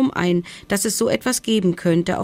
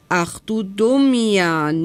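slow, drawn-out feline scolding; meowing cat; 2 semitones higher, 3 dB louder, slower than usual. A narrator's reading. Tomcat chiding the hedgehog, in a slow meow. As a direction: stretch the vowels like a miaow. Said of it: "miaute der Kater".

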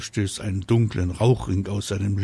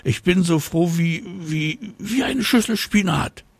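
delighted mama dragon; 10 semitones higher, 3 dB louder than usual. Acted. Mama dragon searching simile.

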